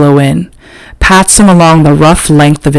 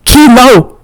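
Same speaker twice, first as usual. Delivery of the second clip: high, louder name more coughed than yelled; 8 semitones higher, 3 dB louder than usual. A narrator's reading. Rico calling his dog off, coughing the name out. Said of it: "more a cough than a yell".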